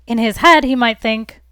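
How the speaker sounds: ordinary delivery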